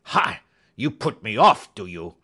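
loud scornful bark, then dropping; angry outburst. Acted. Roylott reacts to being put off, angry outburst. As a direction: burst out loudly, indignant.

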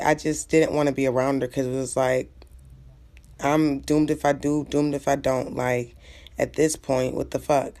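ordinary talk

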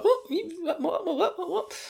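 high, breathy baffled stammer; confused Flotch. Retold or imitated. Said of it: "And Flotch is like".

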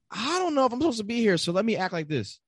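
high, quick, anxious inner voice; imposter inner voice. Retold or imitated. Guest mimicking his imposter syndrome, high and hurried. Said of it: "imposter syndrome where I'm like".

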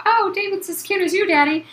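high-pitched imitation of a fawning daughter; mock daughterly gushing. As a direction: pitch it way up, playfully imitating a girl to Daddy. Acting out Jessica talking to Daddy.

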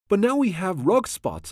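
mild storybook yell, pitched high; a restrained, high-pitched cry of complaint. A narrator's reading. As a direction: raise the pitch in narrated alarm. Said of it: "I yelled".

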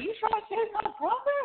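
high, hammed-up threatening brawler; mock a drunk ready to fight; you trying to take my brother; imitating drunk Howard. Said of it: "Howard is like".

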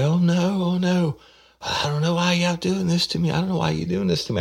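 falsetto panicked woman pleading; high mock wail. Detective imitates the suspect pleading innocence, falsetto whine. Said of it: "she's like".

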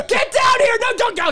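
high loud command; calling shout. Real, not acted. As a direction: yell for it to come down now. Calling the animal down.

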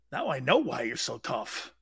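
ordinary talk

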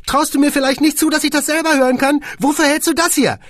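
high, loud and offended; indignant squeaky boss. A narrator's reading. Boss scolds Kunze, high and loud. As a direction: puff up like an insulted little man.

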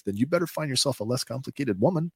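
normal talking voice

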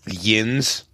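"Yins" as one rising syllable, "yinz"; Pittsburgh "Yins". Shown as a demonstration. Guest shows Pittsburgh's big word, local pronunciation of Yins. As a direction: say Yins with a short i and buzzed z. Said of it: "That's their big thing".

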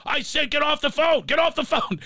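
high-pitched furious yell; he's lost all patience; retold screaming. Exec screaming at the kid to get off the phone. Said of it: "He's screaming".

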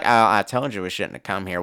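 low, quiet man's voice; Sam Elliott. Retold or imitated. Man to crying girlfriend, low and laid-back. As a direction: drop low and soft, comforting.

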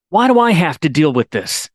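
ordinary talk, nothing marked

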